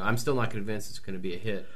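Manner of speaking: usual speaking voice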